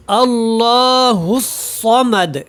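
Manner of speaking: اللہ الصمد slow, shadda held; recitation demo. Shown as a demonstration. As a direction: show the correct join. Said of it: "غلطی وہ یہاں پہ ہے". (شد کے ساتھ تلاوت)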